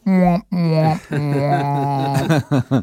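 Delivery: high, muffled phone squawk; imitating a voice through a phone. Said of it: "heard through a phone on a text".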